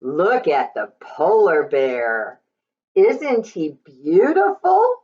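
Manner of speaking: ordinary delivery, nothing marked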